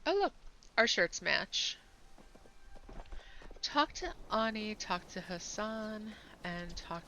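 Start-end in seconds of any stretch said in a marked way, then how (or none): none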